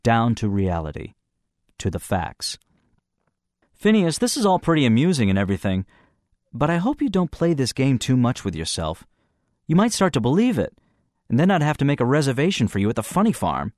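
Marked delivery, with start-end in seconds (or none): 3.8-13.7 lightly voiced Gene. A narrator's reading. Gene teasing Phineas.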